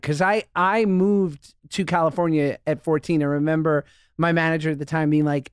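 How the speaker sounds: normal talking voice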